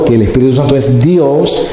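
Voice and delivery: ordinary delivery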